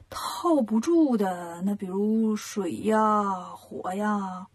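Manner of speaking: slow, drawn-out thinking aloud; slow pondering. A narrator's reading. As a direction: work it out as you speak, stretching each word. Character pondering what cannot be caught.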